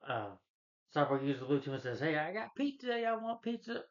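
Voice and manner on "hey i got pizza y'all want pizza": much higher, slow, cheery; cheerful teen hero, higher and slower; goofy pizza offer. Retold or imitated. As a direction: sing it out like a happy goof.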